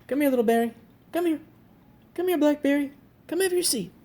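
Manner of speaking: high, lively pet-talk; high-pitched, eager coaxing; coaxing pet call. Real, not acted. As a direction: call a pet over brightly, voice way up. Calling a shy cat over.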